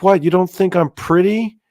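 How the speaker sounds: high, mock-hurt girl voice; creepy girl. Fly girl asking the horrified narrator if she's pretty, high and mock-hurt. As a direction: play wounded in a strange high voice. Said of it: "And she's like".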